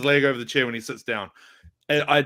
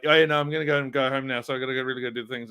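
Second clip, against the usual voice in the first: rapid, run-together, falling off; fast Kiwi speech; 3 semitones lower, faster than usual. Retold or imitated. Back home in New Zealand talking to Mum and Dad, fast. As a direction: rattle it off quickly. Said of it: "By the end of it, I'm just like".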